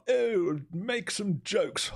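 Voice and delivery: high, stretched-out silly voice; mock comic voice. Streamer sends up English news as comedy, high mocking voice. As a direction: put on a high voice and drag the words.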